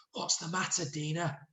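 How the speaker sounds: soft, hushed question; whispered. Shown as a demonstration. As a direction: say it softly, as if secret. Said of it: "whispered Sid".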